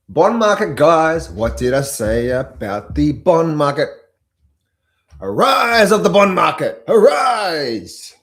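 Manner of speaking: loud, proclaiming hype; hyped announcement. Real, not acted. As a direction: announce the bond market's rise. Hyping the bond market.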